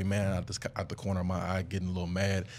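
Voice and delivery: ordinary delivery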